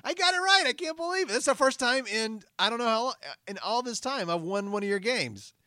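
thrilled winner. Real, not acted. Winning the quiz at last.

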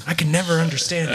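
ordinary delivery

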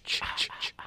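whispered ch ch ch; breathy horror-score whisper; bionic power sound. Retold or imitated. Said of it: "It goes".

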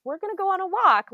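high, loud, quick cheery announcement; Donald announcing. Retold or imitated. Donald telling the fan they'll walk, high and loud. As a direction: proudly declare the walk. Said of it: "he's like".

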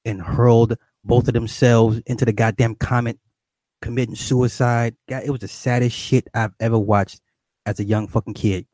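ordinary talk, nothing marked

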